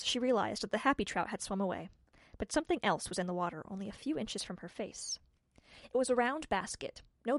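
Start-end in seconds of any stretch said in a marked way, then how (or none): none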